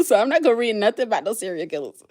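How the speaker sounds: ordinary talk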